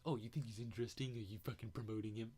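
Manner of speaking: imitating an indignant accuser; mock accusation. Mimics accusing critics of Rogan.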